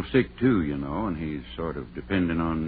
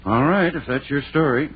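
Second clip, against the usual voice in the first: louder and higher, openly doubting; sceptical; 6 semitones higher, 6 dB louder than usual. Acted. Doctor answers the couple's story, sceptical and raised. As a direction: make clear you don't buy a word of it.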